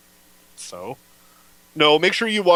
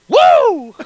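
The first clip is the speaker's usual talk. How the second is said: high loud whoop; excited whoop; 11 dB louder than usual. Real, not acted. Actor cheering his own line, loud whoop.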